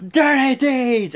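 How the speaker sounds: high, slow hammed-up yell of dirty deeds; finisher call. Wrestler calling his finisher, slow mock yell. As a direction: bellow the move name like a wrestler. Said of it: "he's like".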